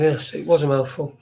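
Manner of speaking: normal talking voice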